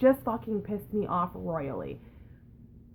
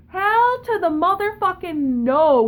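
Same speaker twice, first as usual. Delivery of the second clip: loud, slow, higher hell no; emphatic outburst; 9 semitones higher, 8 dB louder, slower than usual. Real, not acted. Ranting to viewers about the clerk, loud and indignant. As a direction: belt it out, drawn out and indignant.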